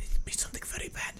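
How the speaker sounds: soft, ominous whisper; scary whisper. Acted. Explaining the German lyrics as the scary guy, ominous whisper. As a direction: whisper it like a sinister warning.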